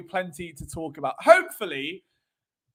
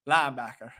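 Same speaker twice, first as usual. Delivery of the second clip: deep, put-on Southern voice; Southern accent; 8 semitones lower than usual. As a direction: play a low-voiced Southerner. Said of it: "do it with a southern ac".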